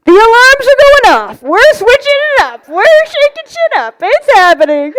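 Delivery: high, loud hyped-up announcement; excited. Acted. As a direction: pump it up like a wake-up alarm. Alarm goes off, hyping it up.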